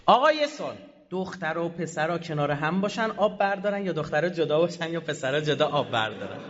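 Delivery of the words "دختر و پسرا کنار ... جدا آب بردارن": ordinary talk, nothing marked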